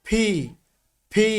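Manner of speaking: ফি as "phi", a p with a puff of air; careful model of ফি, not an f; aspirated P. Shown as a demonstration. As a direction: press the lips, then release ফি with breath. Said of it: "এই লেটারটার pronunciation করছি".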